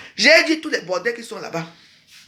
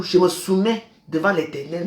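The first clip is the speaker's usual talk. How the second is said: ordinary delivery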